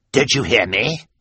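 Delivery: lightly voiced impatient villain; impatient Olaf. A narrator's reading. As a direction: demand obedience. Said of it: "Olaf asked".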